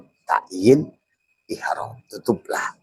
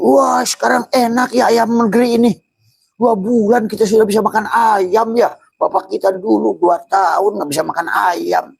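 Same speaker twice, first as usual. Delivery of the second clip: gushing delight; 13 semitones higher, 4 dB louder than usual. Acted. Careless man praising farm chicken.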